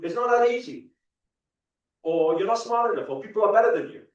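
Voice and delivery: mock the people who tell you no; harsh critics. People telling a dreamer he can't, loud scornful mock.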